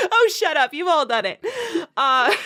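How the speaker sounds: ordinary talk, nothing marked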